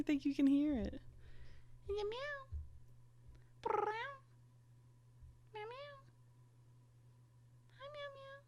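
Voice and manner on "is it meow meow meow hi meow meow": very high, soft squeaky coo. Real, not acted. Owner cooing at her little cat in baby talk. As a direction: coo tenderly at the kitten.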